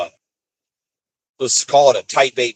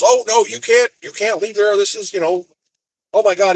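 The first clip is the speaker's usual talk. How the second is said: higher-pitched, agitated yard worker; agitated objection; 9 semitones higher than usual. Retold or imitated. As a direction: imitate a worker frantically objecting. Yard driver objects in retold dispute.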